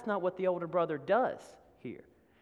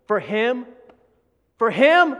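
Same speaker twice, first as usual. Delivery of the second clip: louder and higher, rising in outrage; angry disbelief; 5 semitones higher, 12 dB louder, slower than usual. Acted. Older son protesting the fattened calf to his father, outraged. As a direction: spit out the indignant question. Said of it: "Do you hear the anger?".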